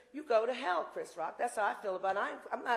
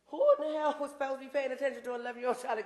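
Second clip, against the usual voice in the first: raised, higher and louder mock outrage; acted indignation; 5 semitones higher, 5 dB louder, faster than usual. Public asking who neglected an eleven-year-old, acted indignant outburst. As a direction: demand it like an outraged onlooker.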